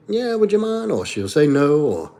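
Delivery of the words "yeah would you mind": higher, sweet asking voice; imitating his wife. Imitating his wife asking him to pick something up, higher. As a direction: lightly voice her polite request. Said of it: "You need anything?".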